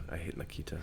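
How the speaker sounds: soft whispered repeat of "I hate Nikita"; a playful whispered aside. Pretend. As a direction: breathe it as a mock secret.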